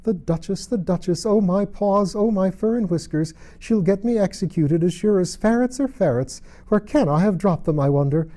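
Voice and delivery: high, loud anxious rabbit voice; fretful muttering rabbit. A narrator's reading. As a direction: fret about the lost gloves. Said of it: "she heard it mutter to itself".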